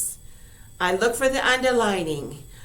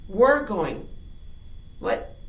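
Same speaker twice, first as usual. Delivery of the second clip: ordinary talk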